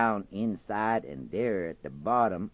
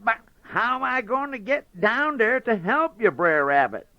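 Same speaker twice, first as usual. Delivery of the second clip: high, loud eager fox voice; anxious Brer Fox; 13 semitones higher, 7 dB louder than usual. Acted. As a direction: press him eagerly. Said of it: "so anxious to get some".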